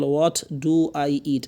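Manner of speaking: What do I eat stretched very slowly; drawn-out classroom model of What do I eat; slow model question. Shown as a demonstration. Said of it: "teacher models question sentence". (খুব ধীরে টেনে বলা প্রশ্ন)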